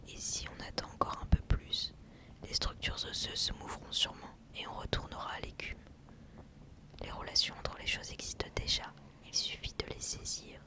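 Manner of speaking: very soft, unvoiced whisper; whispered poem reading. Real, not acted. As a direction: whisper the lines, barely audible. (poème chuchoté tout bas)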